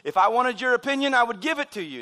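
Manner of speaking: sarcastic dad. Retold or imitated. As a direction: imitate a dad's sarcastic comeback. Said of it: "my dad was being sarcastic, uh, smart aleck".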